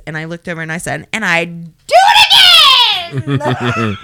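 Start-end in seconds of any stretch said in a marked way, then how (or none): 1.1-4.0 high, drawn-out shout through laughter; defiant yell. Retold or imitated. Woman shouting back at her accuser in front of cops, defiant yell. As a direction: yell it back proudly, much higher and louder.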